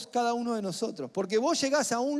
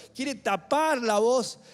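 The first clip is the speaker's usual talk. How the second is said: loud, raised preaching voice; forceful preaching; 4 semitones higher, 7 dB louder than usual. Real, not acted. Preacher warning that the enemy silences believers, forceful and loud. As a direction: build to a ringing, emphatic cry.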